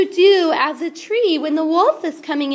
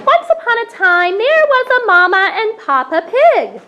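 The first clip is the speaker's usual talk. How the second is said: loud, higher storyteller voice; storytelling narration; 3 semitones higher, 6 dB louder than usual. A narrator's reading. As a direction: tell it big to the audience. Teacher narrates the play loudly.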